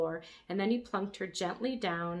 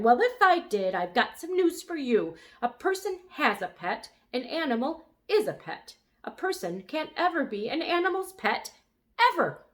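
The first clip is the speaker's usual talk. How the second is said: louder, lecturing know-it-all; bossy; 7 semitones higher, 6 dB louder than usual. A narrator's reading. Lulu lecturing the brontosaurus, bossy. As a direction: lay down the law. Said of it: "back to being its old bossy self".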